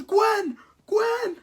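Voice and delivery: very high, loud panicked cry; hammed-up desperate shriek; freaking out. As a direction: scream for a lost love. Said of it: "Peter Parker freaks out".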